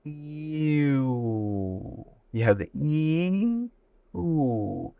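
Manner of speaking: U stretched slowly, ee gliding to oo; drawn-out U. Shown as a demonstration. Teacher demonstrating the diphthong in U, slowly. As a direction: split U into its two sounds. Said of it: "Let's go to the letter U".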